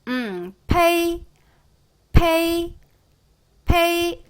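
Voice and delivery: 페이 high, 에 stressed and 이 faint; pinyin pei demo. Shown as a demonstration. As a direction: model 페이 slowly, then crisply. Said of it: "에이, 시작".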